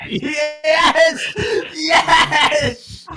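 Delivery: high, loud laughing cheer; triumphant shout. Real, not acted. Cheering as command works.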